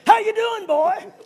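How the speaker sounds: booming high greeting; big man greeting a scared boy loudly. Retold or imitated. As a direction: boom it out like a mean man. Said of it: "Sound like a mean man".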